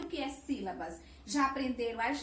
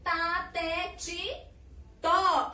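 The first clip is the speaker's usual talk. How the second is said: syllables one at a time, the last punched louder; 3 semitones higher, 4 dB louder, much slower than usual. Shown as a demonstration. Teacher showing children syllables in sequence, syllable by syllable. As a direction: recite each syllable separately, landing hard on "tó". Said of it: "que eu falo organizada em sequência".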